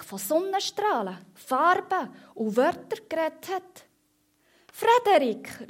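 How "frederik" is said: high, louder call of a name; storybook mice calling their friend. A narrator's reading. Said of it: "rufen sie".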